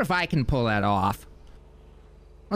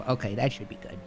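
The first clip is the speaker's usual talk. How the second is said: ordinary talk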